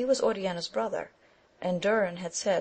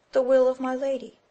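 lady's maid; 7 semitones higher, 6 dB louder than usual. A narrator's reading. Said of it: "to his mistress".

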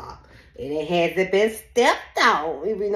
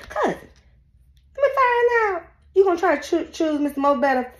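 mock outrage; 10 semitones higher than usual. Real, not acted. Teasing the cheating woman with mock disbelief. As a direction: call her out with high mock shock.

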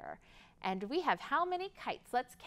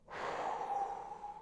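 breathy whoosh; 7 dB quieter than usual. Acted. Wind sound.

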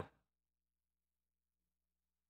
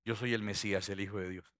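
lower, solemn declaration; lightly voiced Jesus; 7 semitones lower, 6 dB quieter than usual. Jesus reveals plainly to his disciples who he is, lightly voiced solemn tone. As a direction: quietly reveal yourself, voice dropping at the end.

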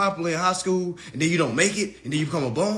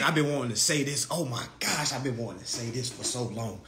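hushed, relishing; 6 semitones lower, 8 dB quieter than usual. Real, not acted. Relishing saying it finally.

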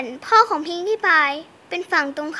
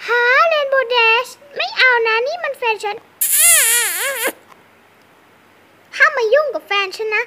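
jealous shout; 5 semitones higher, 3 dB louder than usual. Pretend. Pony guards her boyfriend.

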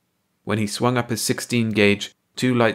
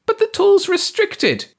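high alien voice; 6 dB louder than usual. A narrator's reading. Ursi protests.

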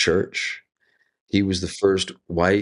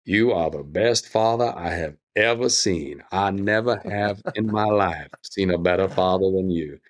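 booming Southern voice. Retold or imitated. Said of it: "he had this booming Southern voice".

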